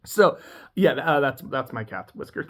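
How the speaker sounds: ordinary delivery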